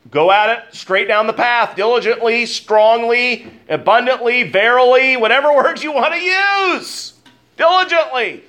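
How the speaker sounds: preacher's mounting excitement, laughing at the end; rising fervor. Real, not acted. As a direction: build the list to a peak.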